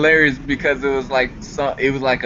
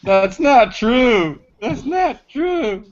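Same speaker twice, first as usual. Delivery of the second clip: hammed-up, high, drawn-out wail; mock-anguished cry, stretched out slowly; melodramatic denial; 11 semitones higher, much slower than usual. Mock-dramatic film-line wail of denial.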